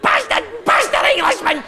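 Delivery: very high-pitched, yapping Scottish shriek; yappy Scottish terrier. Acted. Comedian imitating type-two Scotsman, yappy Scottish terrier voice. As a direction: yelp like a furious little Scottish terrier. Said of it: "Type two is your little yappy terrier jock".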